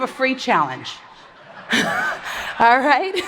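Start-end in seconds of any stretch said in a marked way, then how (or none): 1.7-2.5 quiet airy laugh; soft breathy laugh. Real, not acted. Laughing at own joke.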